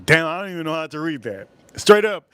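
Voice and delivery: usual speaking voice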